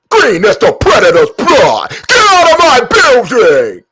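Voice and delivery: high, loud mixtape shout; mixtape hype voice. Pretend. Streamer shouting in his mixtape voice. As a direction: yell it like a hype tape. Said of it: "I gotta do my mixtape voice".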